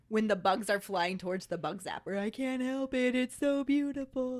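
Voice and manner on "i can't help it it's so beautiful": entranced, held-pitch high voice; a mesmerized bug drawn to the light; spellbound, higher sing-song quote; Bug's Life bug. Acted. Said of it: "like in Bug's Life".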